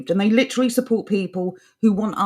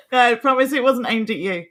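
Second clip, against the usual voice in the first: ordinary talk, nothing marked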